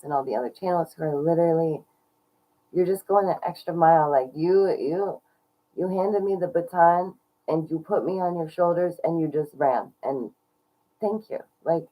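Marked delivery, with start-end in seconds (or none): none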